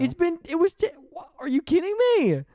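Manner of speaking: high, loud disbelief; shocked disbelief. Real, not acted. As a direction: cry out in shock. Shocked at the lion scene.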